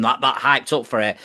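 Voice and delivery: normal talking voice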